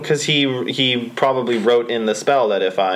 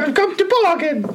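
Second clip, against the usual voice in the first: high, overdone mock Cockney; bad Cockney.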